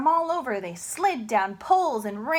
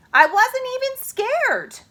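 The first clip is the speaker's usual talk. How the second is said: proud boast; 14 semitones higher, 7 dB louder than usual. Acted. Sheila boasting.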